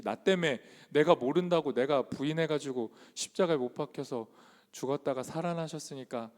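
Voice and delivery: ordinary talk, nothing marked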